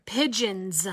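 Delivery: ordinary talk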